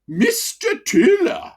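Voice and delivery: miner mocking a posh consultant, x0.7 slow; hammed-up plummy doctor voice; exaggerated posh voice. Old miner mimicking a consultant, exaggerated posh. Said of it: "he said in an exaggerated, posh voice".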